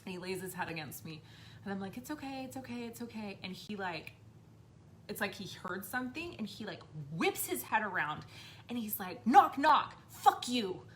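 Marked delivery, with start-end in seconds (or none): 2.0-3.4 soft, higher-pitched soothing; reassuring mom. Retold or imitated. Mom comforting her son at night, reassuring. Mother soothes frightened child, retold. 9.3-10.8 put-on loud, high, punchy kid voice; defiant child. Child telling the spirit off, defiant.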